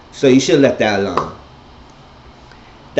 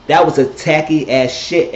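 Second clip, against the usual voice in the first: mad loud; 6 semitones higher, 5 dB louder than usual. Retold or imitated. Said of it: "mad loud".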